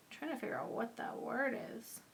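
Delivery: soft unvoiced whisper under her breath; whispered to herself. Real, not acted. Crafter puzzling over a tiny die word, whispering to herself. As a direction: whisper it half to yourself, puzzled. Muttering to herself while searching.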